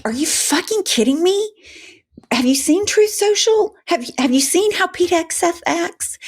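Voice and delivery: are-you-kidding-me exasperation, voice shooting high; exasperated disbelief at a hypocritical response; incredulous outrage. Real, not acted. Outraged rant about administration hypocrisy.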